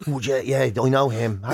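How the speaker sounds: usual speaking voice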